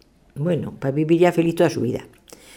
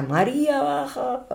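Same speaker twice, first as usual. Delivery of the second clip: calling out; 12 semitones higher, slower than usual. Acted. Calling wife from outside.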